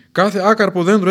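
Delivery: loud stern warning; stern prophet. Acted. John warning the crowd of judgement, loud and stern. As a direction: thunder the warning. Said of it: "έλεγε".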